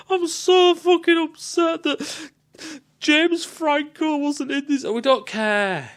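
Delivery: mock crying. Mocking a whiny Covenant character.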